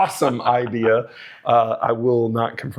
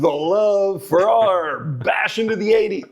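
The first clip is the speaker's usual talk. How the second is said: radio DJ voice; 8 semitones higher than usual. Pretend. Mock radio DJ spot.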